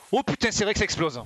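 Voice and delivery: sudden high startled outburst; startled exclamation. Real, not acted. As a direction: react to a sudden explosion. Explosion on screen, startled.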